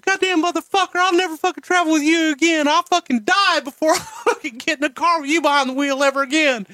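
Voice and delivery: high, strained, cursing rant in a put-on voice; high-pitched ranting put-on voice; Jim Cornette impression. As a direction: do him as a shrill, outraged Southern manager swearing a blue streak. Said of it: "And Cornette said".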